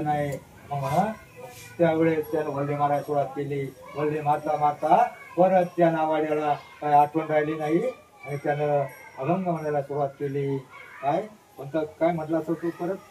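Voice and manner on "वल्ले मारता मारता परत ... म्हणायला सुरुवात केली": plain reading voice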